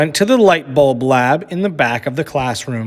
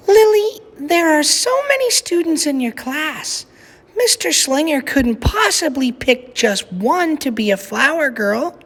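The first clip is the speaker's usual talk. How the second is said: gentle mother; 12 semitones higher than usual. A narrator's reading. Mother at bedtime gently explaining to Lily. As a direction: let her down gently.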